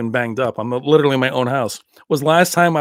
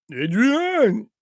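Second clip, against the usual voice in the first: drawn-out, higher boxer's call; mock slurred cry for a sweetheart; Rocky impression; 5 semitones higher than usual. Calling Adrian as a gag, drawn-out Rocky impression. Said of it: "Do Rocky".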